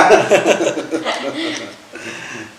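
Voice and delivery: long, high laugh tailing off; real amused laughter, easing off; hearty laugh. Real, not acted. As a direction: laugh loudly at your own joke. Laughs at own joke.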